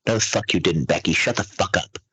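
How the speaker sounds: ordinary delivery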